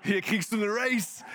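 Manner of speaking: high, loud, exuberant boss; boss announcing a raise. Acted. Boss to employee called into the office, jubilant announcement. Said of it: "dass er dich ins Office ruft und sagst".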